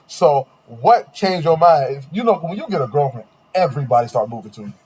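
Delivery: ordinary delivery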